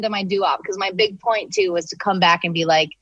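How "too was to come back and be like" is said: ordinary talk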